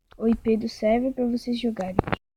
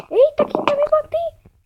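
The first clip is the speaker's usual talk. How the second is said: startled; 13 semitones higher than usual. Real, not acted. Attacked in game, startled.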